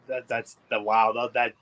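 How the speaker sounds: usual speaking voice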